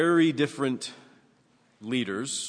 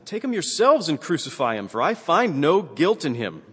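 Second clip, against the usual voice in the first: high, loud declaration as Pilate; emphatic protest; 7 semitones higher, 7 dB louder than usual. A narrator's reading. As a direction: read Pilate's refusal forcefully, pitched right up. Acted quote, Pilate refusing the crowd.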